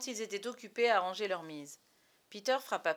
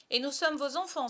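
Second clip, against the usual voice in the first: high, loud eager cry; raised, high-pitched exclamation; 7 semitones higher, 8 dB louder than usual. Acted. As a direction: call out excitedly as the twins. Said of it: "s'écrièrent les jumeaux".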